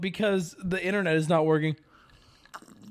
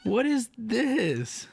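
baffled; 5 semitones higher, much slower than usual. Real, not acted. Baffled at broken stream.

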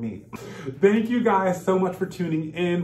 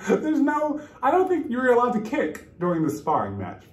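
ordinary delivery

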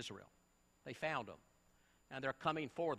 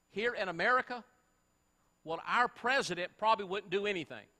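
loud, urgent preacher's call; rally the congregation to take action; preaching at full force; 5 dB louder than usual. Acted.

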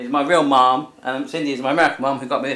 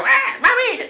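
very high squawking pigeon voice calling mummy; big pigeon; slower than usual. Pretend. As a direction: play a giant pigeon crying for its mum. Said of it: "Just come in as a big pigeon".

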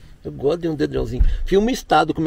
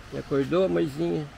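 ordinary delivery

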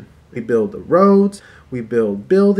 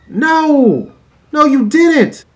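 indignant shout; 11 semitones higher, 7 dB louder, much slower than usual. Real, not acted. Rebutting the imagined couple.

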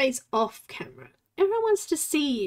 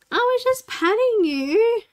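hurt complaint; 9 semitones higher, 6 dB louder than usual. Real, not acted. Complaining to biting cat.

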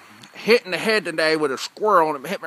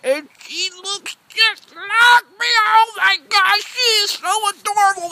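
gushing; 12 semitones higher, 4 dB louder, slower than usual. Real, not acted. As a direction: coo it out in a high gushing voice. Gushing over his baby.

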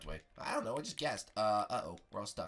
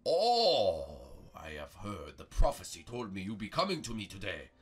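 Master Prego; 7 dB louder than usual. Pretend.

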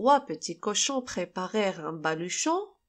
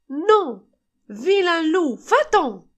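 high, loud scared shout; acted alarm, pitched up and forceful; frightened cry; 7 semitones higher, 7 dB louder, slower than usual. A narrator's reading. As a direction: cry out as frightened little pigs. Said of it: "s'écrièrent les deux frères effrayés".

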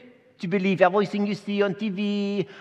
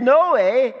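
higher, louder no way; mock Zachariah; 8 semitones higher, 6 dB louder than usual. Retelling Zachariah's doubt, higher and louder. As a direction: blurt out disbelief. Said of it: "So he said".

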